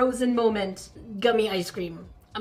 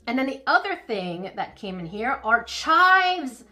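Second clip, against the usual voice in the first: normal talking voice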